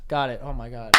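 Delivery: ordinary talk, nothing marked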